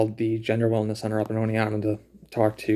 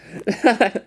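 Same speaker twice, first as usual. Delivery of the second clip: short laugh; 11 semitones higher, 4 dB louder than usual. Real, not acted. Laughs at own name pun.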